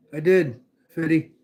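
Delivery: usual speaking voice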